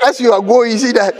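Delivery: ordinary talk, nothing marked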